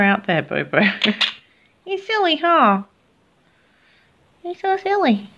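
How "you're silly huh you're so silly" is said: high fond teasing of a pet; cooing high at the bird; pet talk. Real, not acted.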